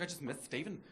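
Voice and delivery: soft, lovesick camp sigh of a line; a dreamy camp murmur; camp pining. Retold or imitated. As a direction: pine for him in a camp, soft voice. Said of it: "couldn't stop being camp".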